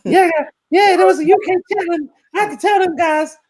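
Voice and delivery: squeaky South Park Canadian send-up. Retold or imitated. Streamer mocks a Toronto chatter in cartoon Canadian voice. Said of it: "how they do the Canadians and South Park".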